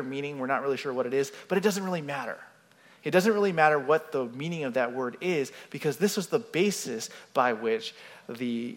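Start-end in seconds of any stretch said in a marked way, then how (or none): none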